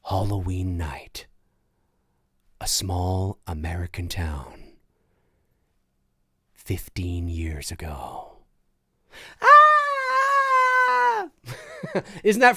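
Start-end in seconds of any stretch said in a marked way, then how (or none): 0.0-8.4 low, slow trailer narration sinking to a whisper; ominous movie-trailer voiceover, ending whispered; horror trailer voice. Pretend. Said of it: "hit us with an improv Halloween trailer voiceover". 9.4-11.3 sudden shrieking scream, very high; horror scream. Pretend. Ends the trailer improv.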